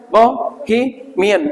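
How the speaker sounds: "बोहेमियन" slowly broken into bo, hi, mian; syllable by syllable. Shown as a demonstration. Teacher showing students how it is pronounced, slow syllabified "बोहेमियन". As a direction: sound out "बोहेमियन" in separate slow pieces. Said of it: "किस तरीके से प्रोनाउंसिएट किया जाता है".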